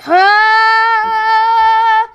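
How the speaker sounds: long, high, held "ah"; frustrated wail. Real, not acted. Player venting at the game, frustrated wail. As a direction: let out one sustained high wail.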